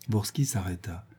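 ordinary delivery

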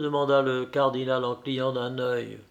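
usual speaking voice

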